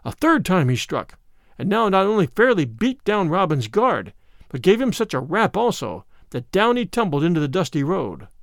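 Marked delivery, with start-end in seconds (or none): none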